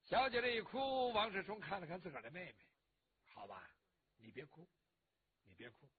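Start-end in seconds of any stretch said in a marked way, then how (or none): 3.3-5.9 very low, hushed comforting voice; soft soothing. A narrator's reading. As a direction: soothe a crying sister, barely voiced. Brother soothes his crying sister.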